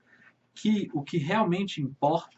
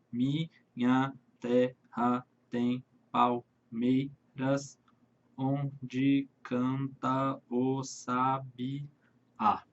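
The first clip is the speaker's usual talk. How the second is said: slow scansion; 3 semitones lower, much slower than usual. Shown as a demonstration. Teacher scanning the verse slowly for students, syllable by syllable. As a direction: scan the verse slowly for the class. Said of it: "Mas a separação de sílabas poéticas".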